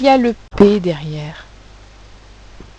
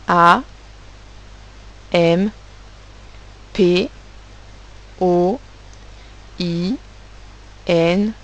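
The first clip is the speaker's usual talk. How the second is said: letter by letter; much slower than usual. Shown as a demonstration. Teacher redictating letters one by one for writers, slow spelling. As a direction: spell it out with long pauses. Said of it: "On va redire les lettres une par une".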